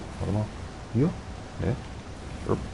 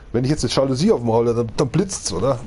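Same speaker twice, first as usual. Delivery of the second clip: ordinary talk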